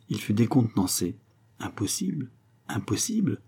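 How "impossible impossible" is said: soft, higher, questioning murmur; murmured disbelief. A narrator's reading. Disconcerted suitor echoes her refusal, murmured disbelief. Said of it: "murmura-t-il".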